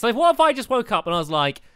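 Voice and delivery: ordinary talk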